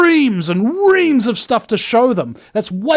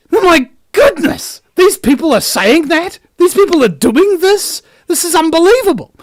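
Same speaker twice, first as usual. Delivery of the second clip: high, loud mock shock at these people; shrill pretend disbelief; mock outrage; 10 semitones higher, 6 dB louder than usual. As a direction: ham up a scandalised gasp. Mocking shocked outsiders.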